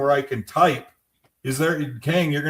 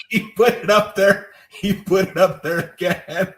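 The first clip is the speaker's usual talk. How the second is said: laughing; 3 semitones higher, 4 dB louder than usual. Real, not acted. Laughing at the posted title.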